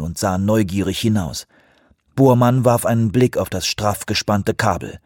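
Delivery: usual speaking voice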